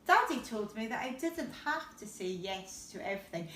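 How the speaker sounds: ordinary delivery, nothing marked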